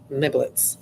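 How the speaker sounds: ordinary delivery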